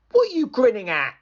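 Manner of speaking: high, fast, cross snap; fast, high irritated retort; annoyed snap. Acted. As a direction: scold the grinning boy. Angry villager scolds Sam.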